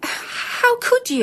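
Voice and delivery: high, slow, wounded disbelief; stunned, aching "how could you"; hurt reproach. Acted. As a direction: let the betrayal crack through, drawn out. Agnes reproaches her maid.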